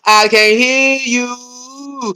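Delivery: slow, drawn-out taunt; mock deafness. Streamer mocking critics by slowly drawing it out. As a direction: stretch it out to mock them.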